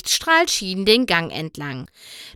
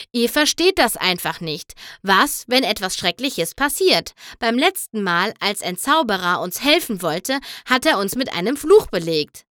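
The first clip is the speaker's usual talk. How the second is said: ordinary delivery, nothing marked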